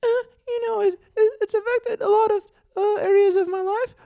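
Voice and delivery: very high, strained voice, halting with hesitations; the patient talks earnestly about his love life in a squeaked voice; damaged voice. Retold or imitated. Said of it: "talks like this".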